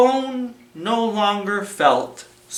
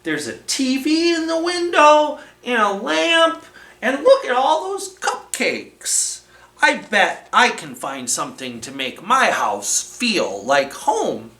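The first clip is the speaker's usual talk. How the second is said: high, delighted little voice; excited tiny character; 6 semitones higher than usual. Acted. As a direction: marvel at the shop window. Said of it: "thought Itty Bitty".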